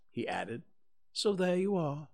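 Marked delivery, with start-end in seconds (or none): 1.1-2.1 a friendly, eager little bear; cheerful bear Pooh. Acted.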